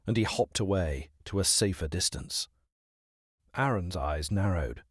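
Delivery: ordinary delivery, nothing marked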